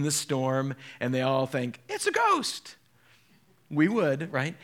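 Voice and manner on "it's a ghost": high-pitched frightened squeal; mock fright. Disciples mistake Jesus for a ghost, mock fright.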